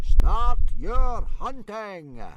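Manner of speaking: high, slow booming call; yell. Acted. Gobber the Belch yelling at the dragons to start, high and drawn out. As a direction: stretch it out like a judge starting a race. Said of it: "yelled Gobber the Belch".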